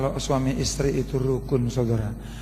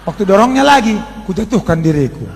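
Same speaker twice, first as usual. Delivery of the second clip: preaching at full force; 7 semitones higher, 6 dB louder than usual. Real, not acted. Animated testimony at full force.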